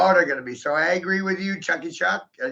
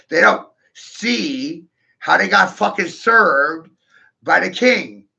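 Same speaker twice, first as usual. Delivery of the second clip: gloating boast; 3 semitones higher, 7 dB louder, slower than usual. Real, not acted. Gloating boast about himself.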